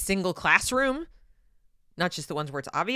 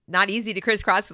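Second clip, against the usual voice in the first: ordinary talk, nothing marked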